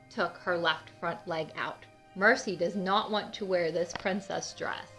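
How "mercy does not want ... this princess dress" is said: usual speaking voice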